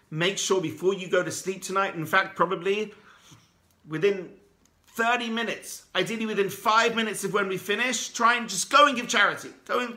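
rally them to act tonight; preaching at full force. Real, not acted. Rabbi urging listeners to give charity now, fervent preaching.

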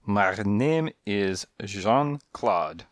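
Jean-Claude with Jean said the French way and Claude said the English way. Acted. Cat gives his name, Jean French and Claude English. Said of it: "He pronounced the thing Jean, but he Englished the Claude".

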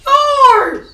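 high, loud tearful wail; crying. Real, not acted. Gamer blaming sibling for lost console, crying wail. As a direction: wail the blame back at them. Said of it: "So why you crying?".

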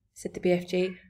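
ordinary delivery

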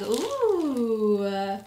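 ordinary talk, nothing marked

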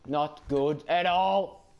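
ordinary talk, nothing marked

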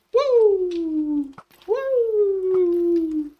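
loud, high, long "woo"; high celebratory howl; excited whoop. Real, not acted. Celebrates the explosion.

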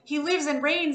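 loud and high, hurried; urgent loud reply. A narrator's reading. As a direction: shout the answer at once. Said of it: "I replied at once".

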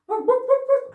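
puppet dog voice. Pretend. Teacher voicing the puppet dog Jump Up for the kids, high loud barking. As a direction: bark it out as an excited little dog. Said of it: "Jump Up, calm down".

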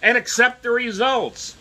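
high mocking yell, imitating the angry press; hammed-up indignant shout at the questioners. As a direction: sneer it out like a furious pundit. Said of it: "They're so angry".

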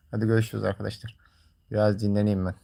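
usual speaking voice

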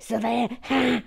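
high, loud gloating cackle of triumph; mock triumph. Gloating to the chat after a cheeky joke, mock triumphant. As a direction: crow it out, pleased with yourself.

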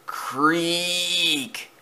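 long, high, soft creaking noise; drawn-out creak. A narrator's reading. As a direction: imitate a creaking board, stretched out. Imitates creaking noise under shed.

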